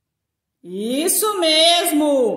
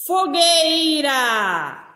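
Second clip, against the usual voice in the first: slow, drawn-out model word; 3 semitones higher, much slower than usual. Shown as a demonstration. Teacher to pupils, showing the whole word: slow, stretched "Fogueira". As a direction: say "Fogueira" slowly, stretching each syllable. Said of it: "E eu tenho o som de".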